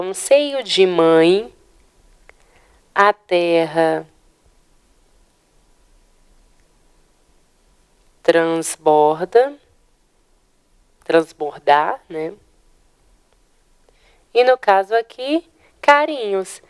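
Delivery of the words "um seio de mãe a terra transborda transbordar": verse drawn out syllable by syllable; slow, measured scanning of the verse; slow scansion. Shown as a demonstration. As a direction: read it slowly, splitting each poetic syllable. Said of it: "acompanhem comigo".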